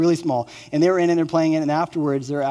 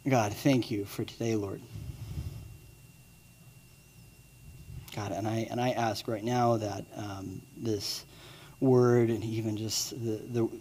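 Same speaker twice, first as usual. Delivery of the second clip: prayer; 4 semitones lower, 7 dB quieter than usual. Acted. Pastor leading the congregation in a soft closing prayer. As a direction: pray quietly and humbly.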